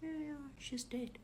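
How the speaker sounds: high, soft, unbothered reply; calm. Retold or imitated. Daughter confirming to robbers her sister is dead, calm and soft. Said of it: "My daughter is calm, I told you".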